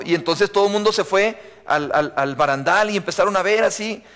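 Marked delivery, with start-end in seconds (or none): none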